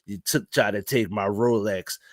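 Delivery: put-on low, soft old-man menace; Boston Strangler voice. Reacting to a news clip, mock old strangler voice, low and soft. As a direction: imitate a creepy old man bragging. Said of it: "sounded like the Boston Strangler".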